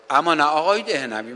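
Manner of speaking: ordinary talk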